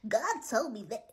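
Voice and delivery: a hint of whiny American accent; whining American accent. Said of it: "like this whining in my ears".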